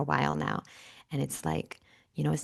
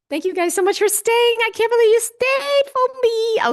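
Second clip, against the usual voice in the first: much higher, louder thrilled thanks; squeaky thanks; 7 dB louder than usual. Real, not acted. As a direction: squeak out your gratitude. Thanking viewers, still in falsetto.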